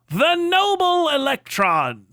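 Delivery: high, slow, grand cartoon narrator voice; hammed-up noble announcer; a mock-heroic cartoon scientist line; Venture Brothers narrator. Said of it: "the most Venture Brothers statement".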